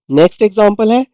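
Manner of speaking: normal talking voice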